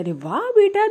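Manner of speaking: high, loud wow; mother's high delighted cry; delighted exclaim. Retold or imitated. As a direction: gush with delight.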